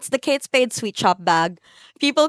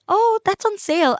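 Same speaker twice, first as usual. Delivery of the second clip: put-on bright sales girl; 11 semitones higher than usual. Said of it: "The sales girl said".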